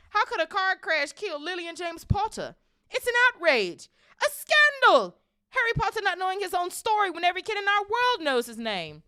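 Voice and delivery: high, full-volume outrage; angry roar. A narrator's reading. Hagrid outraged Harry doesn't know his own story, roaring. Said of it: "jumping up so angrily".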